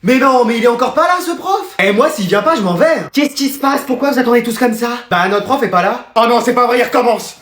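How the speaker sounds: ordinary delivery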